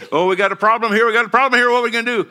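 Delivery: rising, fretful worried caller; imitating worried staff on the phone; mock panic. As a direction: play the panicky associate pastor. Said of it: "they don't call us and say".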